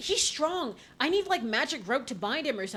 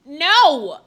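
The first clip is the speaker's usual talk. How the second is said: sudden loud shout; loud protest; 4 semitones higher, 16 dB louder than usual. Real, not acted. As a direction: blurt it out loud. Reacting loudly to game.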